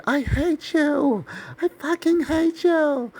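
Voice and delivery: high-pitched drawn-out mock scream; retold screaming. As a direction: screech it slowly, stretching each word. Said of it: "while we were yelling at each other".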